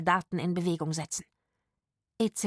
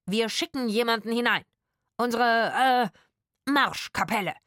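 raised voice with a searching "äh"; improvised announcement; 4 semitones higher, 4 dB louder than usual. A narrator's reading. Rufus bluffing the actors from outside, improvised announcement. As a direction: call it out while making it up.

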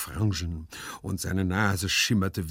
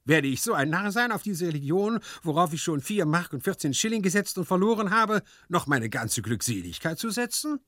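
higher-pitched, worked-up servant voice; indignant servant figure; 10 semitones higher than usual. A narrator's reading. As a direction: argue your practical case in a high voice. Hyazinth voiced in the story. (empörter Diener, hohe Stimme)

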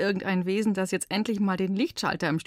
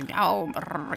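clumsy meow. Pretend. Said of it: "ich kann nicht so gut miauen".

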